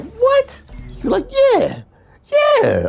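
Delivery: imitating Fred losing an argument, high put-on voice; Fred's excuse voice. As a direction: squeak out a smug excuse.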